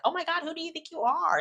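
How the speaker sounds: high, fast, dismissive mock voice; snooty mock. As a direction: toss it off like a stuck-up diva. Mocking a snooty reply.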